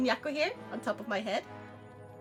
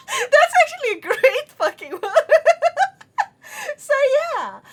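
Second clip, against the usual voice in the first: loud shrieking laughter; laughing hard; 11 semitones higher, 10 dB louder than usual. Real, not acted. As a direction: crack up laughing. Laughing at limerick.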